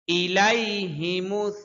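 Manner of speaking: ইলাইহিমুস drawn out slowly, each letter clear; slow recitation model. Shown as a demonstration. Teacher models the verse for the class, slow and careful. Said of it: "teacher models Quran recitation".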